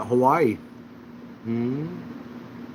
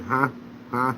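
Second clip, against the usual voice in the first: imitating uncle; 5 dB quieter than usual. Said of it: "He'd talk like this".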